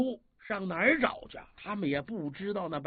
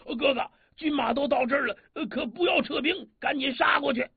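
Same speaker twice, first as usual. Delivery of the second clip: eager protest; 6 semitones higher, 3 dB louder than usual. Acted. Li Kui protests retreat.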